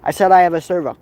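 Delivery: normal talking voice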